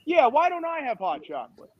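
high mock-childish complaint; put-on whine of jealousy; jealous kid. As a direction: pester Mom like an envious kid. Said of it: "I'm jealous 'cause I don't have".

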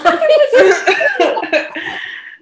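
high, loud burst of real laughter; loud laughing burst. Real, not acted. Sister laughs out loud recalling mom's words, a loud real laugh. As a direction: crack up loudly at the absurd line.

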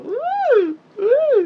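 very high, loud "uh" squeals. Retold or imitated. After dad says go to bed, high imitated squeals. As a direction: squeal it out twice, far up in pitch.

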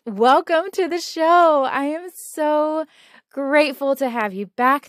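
excited greeting. Acted. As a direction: burst into the welcome. Live greeting after meditation.

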